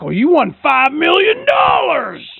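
high, loud hammed-up scammer pitch; mock scammer voice. Scammer on the phone announcing a fake lottery win, hammed up. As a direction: shout it like a cheesy prize announcer.

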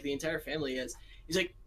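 ordinary delivery

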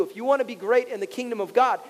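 normal talking voice

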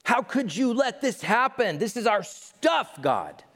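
high, slow, aggrieved whine; mock whiny lament; whining kings. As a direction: complain like a spoiled ruler. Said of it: "they're like".

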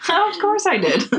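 high and slow through laughter; laughing. Real, not acted. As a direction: laugh the words out stretched. Repeats own words laughing.

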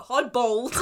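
loud imitated bellow; aggressive greeting. Volturi greeting Bella, mock aggressive shout. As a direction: belt it out like an aggressive villain. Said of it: "he says it really aggressively".

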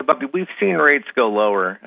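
normal talking voice

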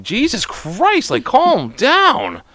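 high, drawn-out, overwhelmed protest; hammed-up pleading to settle down; mock exasperation. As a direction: stretch each word up high as if fed up. Retelling own exasperated reaction.

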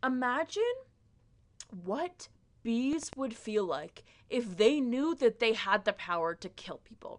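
usual speaking voice

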